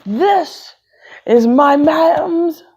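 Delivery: high, loud and slow, stretching out mom's; announcing it grandly to the class; proud announcement. Acted. Boy showing his mom's paper to class, proud and loud.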